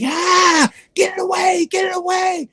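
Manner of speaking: very high, shrieking plea; terrified scream. Retold or imitated. Gang leader screams at the book touching him, terrified. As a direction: shriek to get it away from you. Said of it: "He started screaming".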